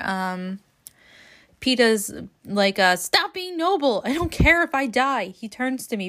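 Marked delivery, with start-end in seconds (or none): 2.9-5.3 pleading Peeta. Acted. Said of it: "quick reading here".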